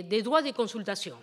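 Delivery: ordinary talk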